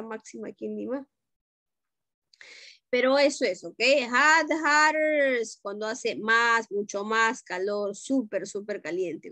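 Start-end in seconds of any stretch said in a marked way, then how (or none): 4.1-5.4 "Hot, hotter" said slowly and deliberately; teacher slowly modelling "Hot, hotter" for students; slow drill. Shown as a demonstration. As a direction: spell out "Hot, hotter" for the class. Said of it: "teacher drills comparative forms".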